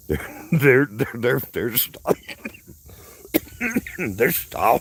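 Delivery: strangled, high-pitched coughing fit; real choking, words caught between coughs. Real, not acted. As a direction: try to talk while choking on something. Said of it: "start choking up here".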